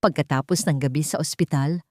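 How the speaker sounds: ordinary delivery, nothing marked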